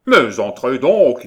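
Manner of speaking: very loud, slow, high summons; drawn-out insistent call; impatient nasal shout. A narrator's reading. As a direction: bellow it with impatience from within. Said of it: "dit une troisième fois la voix".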